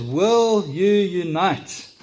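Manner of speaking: loud, slow battle cry to the troops; rallying shout. Retold or imitated. Wallace shouting to the troops, slow rousing battle cry. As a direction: call out like Wallace rousing an army. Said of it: "he shouts to them".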